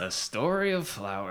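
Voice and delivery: half-hearted troll voice on the title; medieval troll voice. Acted. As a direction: try a medieval troll, then give up. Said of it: "like a medieval troll voice?".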